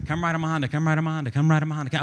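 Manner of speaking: quick, run-together mock tongues; real fast repetition. Shown as a demonstration. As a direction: rattle it off over and over, mocking fake tongues. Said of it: "but real fast over and over".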